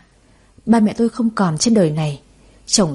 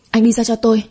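shouting. A narrator's reading. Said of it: "Tôi hét lên".

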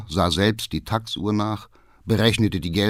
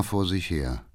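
plain reading voice

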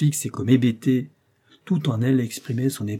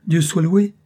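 1 relieved cry; 8 semitones higher, 8 dB louder than usual. A narrator's reading. Doctor's relieved exclamation.

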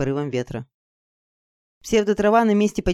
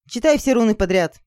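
higher, firm order; commanding; 5 semitones higher, 4 dB louder than usual. Acted. Girl ordering Izergil to read runes, commanding. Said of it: "приказала я".

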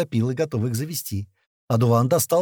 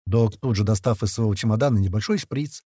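usual speaking voice

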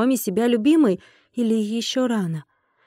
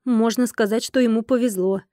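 plain reading voice

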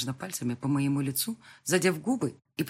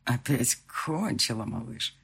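parodying fiancé; 3 semitones lower, slower than usual. Acted. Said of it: "пародировал он Марка".

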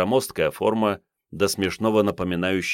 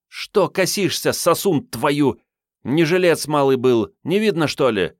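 angry; 9 semitones higher, 4 dB louder than usual. A narrator's reading. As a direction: snap at him harshly. Katrin snapping at soldier.

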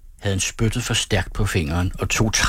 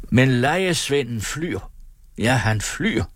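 raised pitch, leaning on "lejesvenden" and "flyr"; emphatic; 5 semitones higher than usual. A narrator's reading. Carlson accuses the runaway hirelings, stressing "lejesvenden" and "flyr". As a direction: underline "lejesvenden flyr" with a higher, swelling voice. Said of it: "understregede han".

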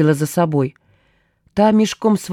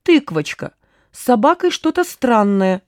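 higher-pitched complaint, not really shouted; mild indignant cry; 5 semitones higher than usual. A narrator's reading. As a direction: call out in mild alarm. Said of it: "негодующий крик Гуркина". (сдержанно негодующий вскрик)

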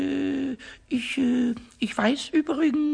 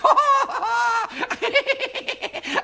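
wild giggle; 10 semitones higher than usual. Acted. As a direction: cackle with naughty glee. Pumuckl laughing at the mischief.

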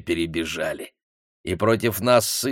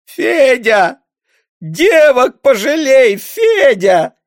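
high, loud, slow wailing lament; wailing plea; 10 dB louder, much slower than usual. A narrator's reading. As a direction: wail and beg for mercy. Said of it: "запричитала Алевтина".

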